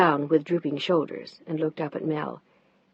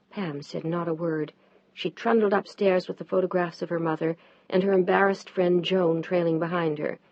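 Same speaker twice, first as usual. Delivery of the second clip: ordinary delivery, nothing marked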